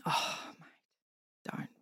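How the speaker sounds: hushed, breathy and moved; close to tears. Real, not acted. Asked about Shane Warne, close to tears. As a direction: barely voice it, choked up. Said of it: "You'll make me cry".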